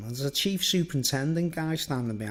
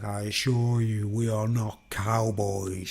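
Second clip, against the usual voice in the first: slow, lower, deadpan officer voice; dead serious; 5 semitones lower, slower than usual. Retold or imitated. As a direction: say it slowly and gravely, not a hint of joke. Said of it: "So dead serious".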